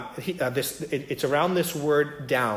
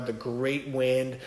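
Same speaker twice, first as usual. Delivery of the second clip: slow, with "great" and "wind" both weighted; "great" made big and the whole phrase slowed; stress on great; much slower than usual. Shown as a demonstration. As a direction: draw out "the great wind" and lean on "great". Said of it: "Everything's emphasized".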